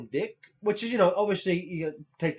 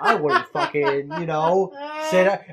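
laughing while quoting; 3 semitones higher, 8 dB louder, slower than usual. Retold or imitated. Laughing through retold rant.

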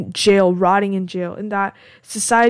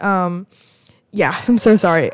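usual speaking voice

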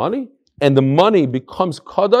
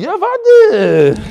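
high, drawn-out mock yell; acted exasperated yell; 7 dB louder than usual. Groom interrupted by a knock mid-chuppah, exasperated yell. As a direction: burst out high and loud, imitating the interrupted man.

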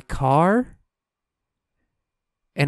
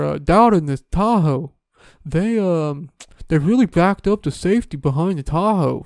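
mock pitch-man testimonial, pitched up; hammed-up proud new-car owner; imitating a car-ad customer; 2 semitones higher than usual. Mocking the commercial's customer, hammed-up voice. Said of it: "that was like".

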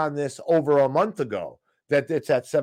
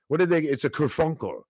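ordinary delivery

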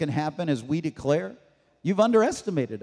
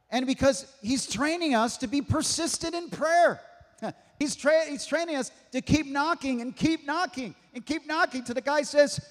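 ordinary talk

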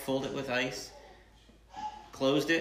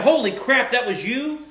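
high, loud disbelieving shout; loud stunned holy crap; shocked yell; 13 semitones higher, 10 dB louder than usual. Retold or imitated. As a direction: blurt it out in shock. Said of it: "then I said".

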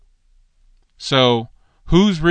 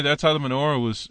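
ordinary talk

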